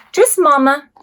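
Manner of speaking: normal talking voice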